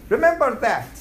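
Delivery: ordinary talk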